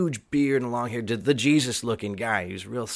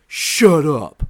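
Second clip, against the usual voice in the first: loud, drawn-out mock outburst; imitated loud disbelief; 4 semitones higher, 11 dB louder than usual. Drunk friend recognizes his old bandmate, imitated loud disbelief. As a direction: blurt it higher, as a drunk who can't believe it.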